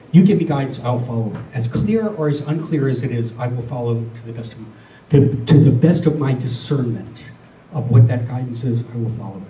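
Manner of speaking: ordinary talk, nothing marked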